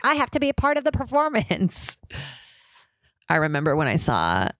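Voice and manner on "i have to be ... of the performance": high, theatrical plea; dramatic insistence. Retold or imitated. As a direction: belt it like a desperate theatre kid. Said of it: "And I'm like".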